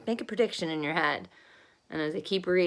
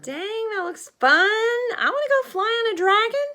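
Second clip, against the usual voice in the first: excited; 7 dB louder than usual. Real, not acted. Child reacting to the story.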